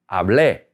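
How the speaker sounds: hablé with a strong final É, falling away; stress on final E. Shown as a demonstration. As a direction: lean firmly on the É of hablé. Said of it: "stress that E when you say it".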